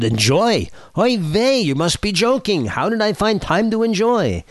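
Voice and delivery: put-on high, exasperated Yiddish-accented rabbi; kvetching old rabbi in a Yiddish accent. Rabbi's exasperated quote in joke.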